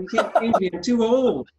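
high, loud mock whine; whiny protest. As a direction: protest like a stubborn old man. Playful protest at rule change.

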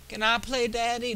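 high-pitched put-on little kid voice; mock timid kid. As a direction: plead like a scared little player. Voices the easiest difficulty option.

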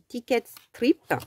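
"ticket strip" said in English with a French accent; English words with a self-mocking French accent. Shown as a demonstration. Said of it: "avec mon accent magnifique". (« ticket strip » avec un accent français)

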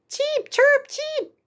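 tiny high chirps; cartoon bird voice. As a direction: chirp like baby birds. Chicks chirping.

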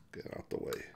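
whispered, low-effort warning; hushed urging. Acted. Sheriff warns Willie out of the way, hushed. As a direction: urge him aside under your breath.

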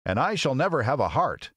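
high character voice, lamenting; Tin Woodman. Acted. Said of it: "spoke the Tin Woodman".

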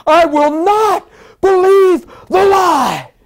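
slow, high, full-force shout; roaring declaration. Retold or imitated. Sick man rising to defy the lie, shouting. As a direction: bellow each phrase in defiance. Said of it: "I don't even talk like this".

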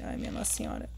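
scared breathy whisper. Real, not acted. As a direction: hush it, terrified. Hiding from the granny. (sussurro assustado)

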